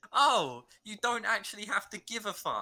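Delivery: high, loud mock teacher voice; hammed-up laid-back teacher; mock casual teacher. Mock tutor, high excited.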